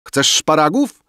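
high, loud called question; calling out. A narrator's reading. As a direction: call it across to a dinner guest. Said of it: "zawołał doń bankier". (zawołanie, głośno i wysoko)

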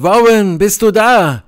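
high, loud call. A narrator's reading. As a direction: shout down into the depths for an answer. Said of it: "Wieder rief ich hinunter". (laut und hoch hinunterrufen)

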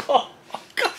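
breathless laughing oh my God; laughing whisper. Real, not acted. Laughing aside.

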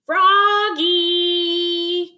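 high sing-song call. A narrator's reading. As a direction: call a child in from afar, stretching the name. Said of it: "called his mother".

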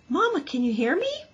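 high worried little gosling; a small child voice pleading to his mother; anxious gosling. Acted.

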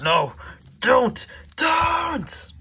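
high, loud begging cry; panicked plea. Acted. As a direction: beg in panic. Pleading in fight.

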